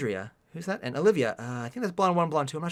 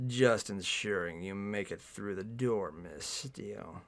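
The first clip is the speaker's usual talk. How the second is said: slow, deep, hushed voice; suave leading man; 10 semitones lower, 11 dB quieter, much slower than usual. A narrator's reading. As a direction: murmur it low, sinking on the name. Voices Gray in the story.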